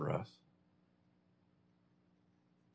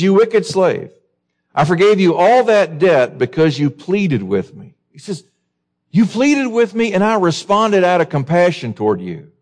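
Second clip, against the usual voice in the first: the king's rebuke, pitched higher and firm; stern master rebuking; 6 semitones higher than usual. Acted. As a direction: scold the servant as the king.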